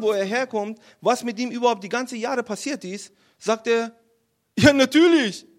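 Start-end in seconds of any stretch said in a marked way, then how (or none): 4.6-5.5 loud, high burst of eagerness; eager agreement. Acted. David accepting the king's offer, eager and loud.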